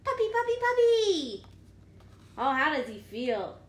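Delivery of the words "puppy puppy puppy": high, loud joyful cries; excited shout. Acted. Pigeon shouting his wish to the reader, overjoyed. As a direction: shout it out with glee.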